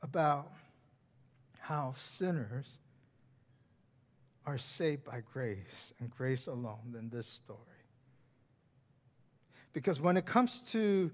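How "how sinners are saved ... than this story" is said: whisper. Real, not acted. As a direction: lean in and whisper the grace line. Sermon hushed to a whisper.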